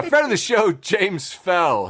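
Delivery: ordinary delivery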